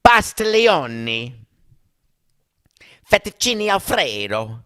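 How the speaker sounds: big exaggerated Italian accent; mock Italian accent. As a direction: ham up an over-the-top Italian movie accent. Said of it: "English with an Italian accent".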